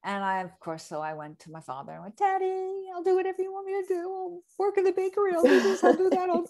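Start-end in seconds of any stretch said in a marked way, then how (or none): 2.2-6.4 put-on high, fast, begging little-girl voice; much higher, breathless pleading with a laugh; pleading daddy's girl. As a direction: wheedle your father like a kid begging for cash. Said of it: "I was daddy's girl".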